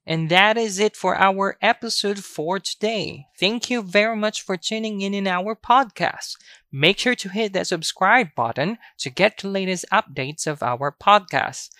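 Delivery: peppy scripted closing; podcast sign-off. Real, not acted. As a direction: sign off to the listeners with lifted energy. Podcast sign-off after word drill.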